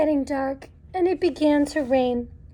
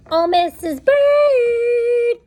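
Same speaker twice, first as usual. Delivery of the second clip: slow, drawn-out call into the chimney; 8 semitones higher, 5 dB louder, much slower than usual. Acted. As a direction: stretch the name out as you call down. Calling down the chimney.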